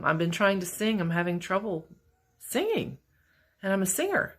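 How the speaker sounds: ordinary talk, nothing marked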